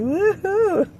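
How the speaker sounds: high, whooping retold cheer; playful re-enacted joyful whoop; mock cheer. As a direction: whoop it up as if driving along delighted. Retells cheering while driving.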